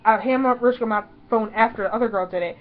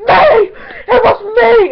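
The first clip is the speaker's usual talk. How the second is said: high, loud cry of self-identification; excited high yell; frantic claiming shout; 12 semitones higher, 10 dB louder, slower than usual. Retold or imitated. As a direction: shout it out to be picked from a crowd. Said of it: "almost like that thing from that one movie".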